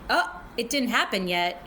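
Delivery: ordinary delivery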